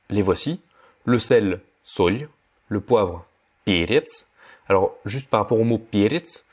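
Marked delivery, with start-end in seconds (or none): none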